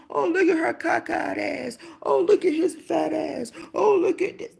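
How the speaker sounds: loud, high mocking jeer; mocking gossip. Imitating mean people jeering at others, loud mock shout. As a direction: shout the insults like a cruel onlooker. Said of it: "Trying to tell the person".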